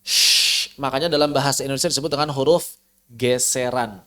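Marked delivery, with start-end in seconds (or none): 0.0-0.7 unvoiced Shh with friction; shin hiss. Shown as a demonstration. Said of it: "seperti ada gesekan".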